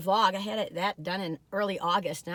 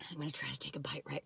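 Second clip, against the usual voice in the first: soft whisper before a bite; 7 semitones lower, 14 dB quieter, faster than usual. Real, not acted. Food reviewer whispering while tasting a cookie. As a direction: whisper as if not to spoil it.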